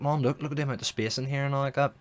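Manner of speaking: acted-out eager husband; eager showing-off. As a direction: urge someone over to come and look. Husband showing off new fridge to wife.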